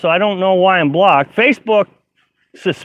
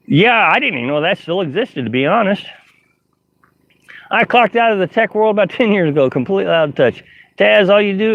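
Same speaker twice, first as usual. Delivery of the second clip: ordinary talk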